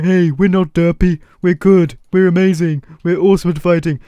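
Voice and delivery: silly put-on villager voice; goofy mock-proud villager boasting; derpy villagers. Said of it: "they are derpy villagers".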